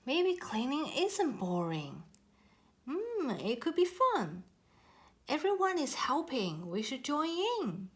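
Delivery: a curious koala talking itself into joining. A narrator's reading. Koala muses to the others about joining the cleanup.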